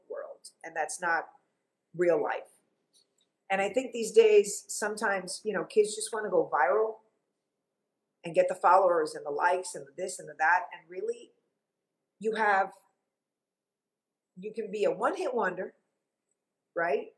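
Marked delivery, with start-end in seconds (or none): none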